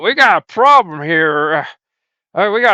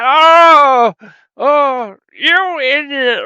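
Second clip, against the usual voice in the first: high, loud scolding cry; exasperated yell; 8 semitones higher, 5 dB louder, much slower than usual. Acted. Host exploding at his partner for forgetting. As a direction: blow up at the clueless partner.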